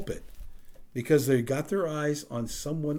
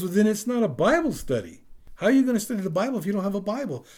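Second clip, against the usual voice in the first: high, loud, retold protest; incredulous challenge; 9 semitones higher, 7 dB louder, faster than usual. Friend challenging the Bible study hosts, incredulous and high. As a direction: push back loudly, acting out a baffled objector.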